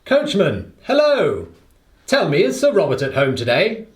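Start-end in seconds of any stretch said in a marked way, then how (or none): 0.0-1.5 flag down the passing carriage with a called hello; hailing the coachman. Acted. Watson hails the passing coachman, calling out. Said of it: "step forward and hail the coachman".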